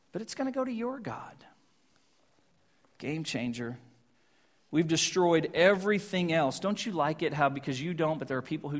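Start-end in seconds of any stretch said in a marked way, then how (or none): 0.1-3.8 whispered, sly aside. Acted. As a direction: whisper it like a secret excuse.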